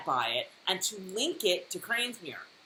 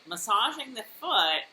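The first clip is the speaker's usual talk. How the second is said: normal talking voice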